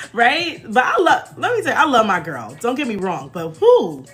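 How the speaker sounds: usual speaking voice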